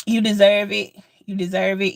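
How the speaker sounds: ordinary talk, nothing marked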